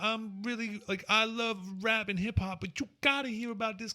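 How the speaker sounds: high squeaky laughing rapper voice; high-pitched hype-man voice. Retold or imitated. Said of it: "and he's like".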